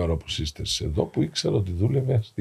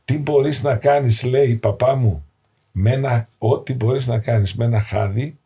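louder, higher preaching voice; fervent preaching; 4 semitones higher, 6 dB louder than usual. Real, not acted. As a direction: proclaim it with full conviction. Priest preaches elder's teaching.

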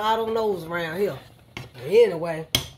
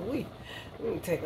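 ordinary talk, nothing marked

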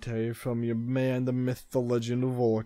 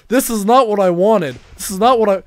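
louder higher exasperated protest; frustrated outburst; 6 semitones higher, 10 dB louder than usual. Real, not acted. Gamer raging at a chaotic match, exasperated. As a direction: let the frustration boil over.

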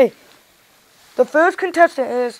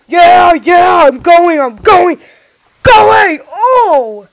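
louder, higher joyful yells; excited shouting; 5 semitones higher, 6 dB louder, much slower than usual. Pretend. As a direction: shout with glee. Contestant excitedly advances.

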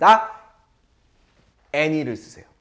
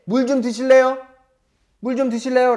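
polite flight attendant; 7 semitones higher than usual. Acted. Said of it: "제가 스튜어디스입니다".

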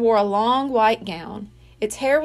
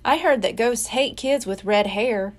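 ordinary delivery

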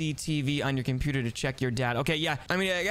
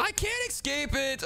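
high, slow wail; panicked wail; 9 semitones higher, slower than usual. Pretend. Brother stuck on the screen, panicked. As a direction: wail it as if trapped.

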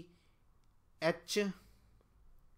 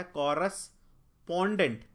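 Correspondent drawn out slowly, syllable by syllable; slow careful pronunciation; 4 dB louder, slower than usual. Shown as a demonstration. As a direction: sound out the spelling slowly. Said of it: "pendant नहीं होता". (धीरे, अक्षर-अक्षर बोलकर)

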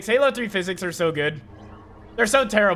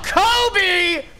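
excited callout; 10 semitones higher than usual. Real, not acted. Throws grenade, calls out.

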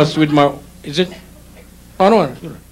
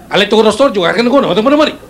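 words jumbled together, higher and rushed; rapid higher-pitched Filipino patter; fast Filipino jumble; 5 semitones higher, much faster than usual. Shown as a demonstration. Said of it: "you just jumble all the words together".

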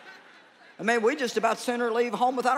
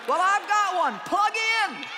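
triumphant shout; 8 semitones higher, slower than usual. Real, not acted. Preacher answers the frustrated crowd with a triumphant shout. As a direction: hit the answer hard and high.

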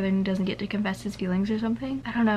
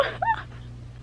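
soft, very high giggle; high breathless laugh; 6 dB quieter than usual. Real, not acted. Reader delighted by his confession, high giggling. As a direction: dissolve into squeaky breathless laughter.

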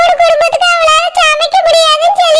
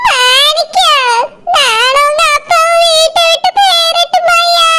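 slow, sing-song wailing lament, hammed-up; fake wailing. Mother-in-law guilt-trips her son with a staged sobbing wail. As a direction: stretch his name out in a put-on sob, then keen the question. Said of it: "மாறி மாறி பேசிட்டு இருக்காவ".

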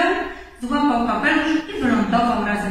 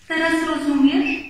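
put-on higher, louder child's question; little child character; 6 semitones higher, 5 dB louder than usual. A narrator's reading. Little Roo checks that Rabbit understands, in child voice. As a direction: pipe up like an eager little one.

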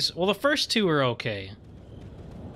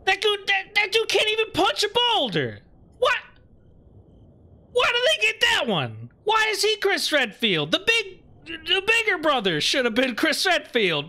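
outraged rant. Real, not acted. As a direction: rant in total disbelief at the casting. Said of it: "I'm really upset about this".

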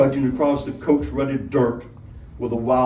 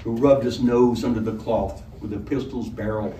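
ordinary delivery, nothing marked

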